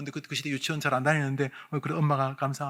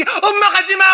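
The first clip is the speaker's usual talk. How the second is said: much higher and louder scream; group urged to yell even louder for mother; louder shout; 17 dB louder than usual. Shown as a demonstration. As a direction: scream it once more, even bigger. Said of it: "조금만 더 크게".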